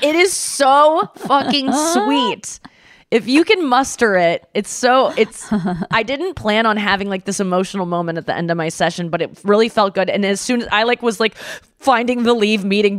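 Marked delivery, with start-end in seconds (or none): none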